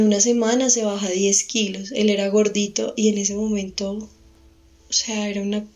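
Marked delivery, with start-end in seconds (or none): none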